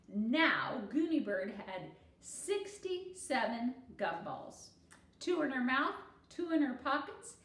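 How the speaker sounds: lively storybook read-aloud voice; expressive children's story reading. A narrator's reading. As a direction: read it to the class with animation. Teacher reads story aloud to class.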